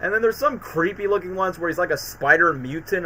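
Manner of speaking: ordinary delivery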